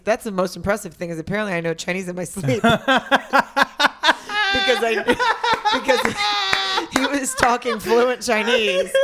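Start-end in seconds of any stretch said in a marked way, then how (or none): 2.7-8.9 high, slow, breaking up with laughter; laughing hard. Real, not acted. Woman explaining her dream to co-host, laughing helplessly. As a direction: struggle to talk through the laughter.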